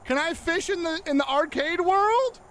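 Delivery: thrilled, reaching high; excited high question. Real, not acted. Excited discovery in game.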